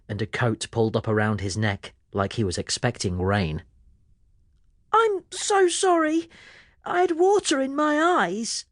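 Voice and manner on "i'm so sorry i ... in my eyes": put-on apologetic boy. A narrator's reading. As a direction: apologise in a rush of guilt. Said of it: "Pete explained".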